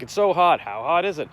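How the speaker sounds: milk the setup like a late-night host; Johnny Carson bit. Retold or imitated. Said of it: "Johnny Carson used to be like".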